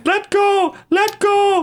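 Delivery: high, frantic cry for help; panicked scream. Pretend. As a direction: scream to be let go. Trapped by robot.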